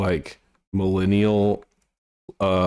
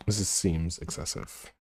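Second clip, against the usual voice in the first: usual speaking voice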